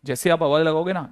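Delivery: ordinary talk, nothing marked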